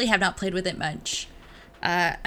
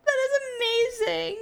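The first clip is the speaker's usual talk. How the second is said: very high laughing squeal; delighted laughter. Real, not acted. Co-host loving the silly simile, helpless delight. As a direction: squeak out praise while cracking up.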